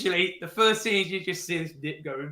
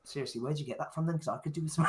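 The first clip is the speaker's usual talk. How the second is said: hushed brother; 2 semitones lower, 12 dB quieter, faster than usual. Retold or imitated. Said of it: "turned down to me and goes".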